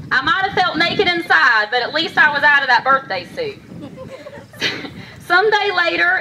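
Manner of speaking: measured rhyming storytelling, pausing between lines; heartfelt poem, phrased line by line; poem recitation. Acted. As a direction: recite a personal poem to an audience. Recites own poem on stage.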